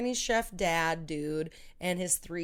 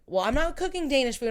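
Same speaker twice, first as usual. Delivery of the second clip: higher, louder and faster, a touch of mock defiance; defiant quote; 7 semitones higher, 6 dB louder, faster than usual. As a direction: lightly play the cook refusing, a bit indignant. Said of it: "and she's like".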